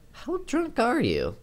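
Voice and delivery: light, teasing and slow, pitched well up; lighthearted. Acted. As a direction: tease her gently, unhurried. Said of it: "I asked lightheartedly".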